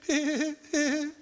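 high-pitched put-on hee-hee titter; small mock giggle in short bursts; fake giggle. Shown as a demonstration. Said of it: "give, giggle".

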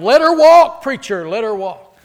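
loud call from the pews; mock congregation yell; yelled from the back. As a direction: shout it out from the back row. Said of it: "someone in the back yelled".

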